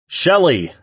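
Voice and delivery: calling out loud and high; shouting. A narrator's reading. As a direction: call across a big hall. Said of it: "he shouted into the realm".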